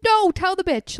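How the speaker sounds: high, loud insistence; emphatic loud. Real, not acted. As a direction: shout it down emphatically. Arguing emphatically with opponents.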